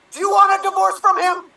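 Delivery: political drama. Acted. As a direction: push harder the second time. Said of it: "political drama".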